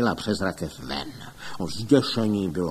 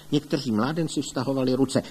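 usual speaking voice

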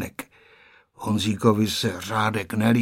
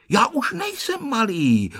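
put-on resolute little boy; 11 semitones higher than usual. A narrator's reading. Honzík objects to being called little, resolute. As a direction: insist in a high, determined boy's voice. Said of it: "odpověděl rozhodným hlasem Honzík".

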